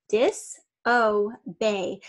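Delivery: "disobey" very slowly, as dis, o, bay; syllable by syllable. Shown as a demonstration. Teacher sounding out disobey for spelling, slow syllable by syllable. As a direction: draw out each part of "disobey" separately.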